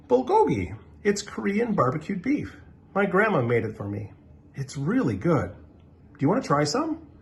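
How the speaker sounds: put-on a friendly kid offering to share; young boy. Justin explains his food.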